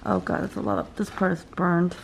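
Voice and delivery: ordinary talk, nothing marked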